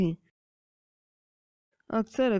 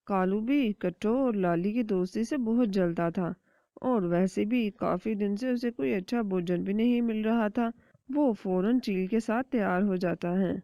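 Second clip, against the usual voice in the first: usual speaking voice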